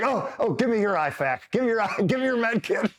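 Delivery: high-pitched imitated eagerness; gleeful buddy. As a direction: demand it in giddy delight, breaking into a laugh. Gleeful buddy wants to treat him.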